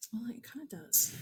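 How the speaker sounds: a quick under-breath whisper; whispered aside. Real, not acted. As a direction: admit it under your breath. Aside correcting herself.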